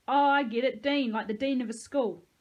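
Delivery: teasing bully. A narrator's reading. Peyton joking about Dean's name, teasing. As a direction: make fun of the name with big energy.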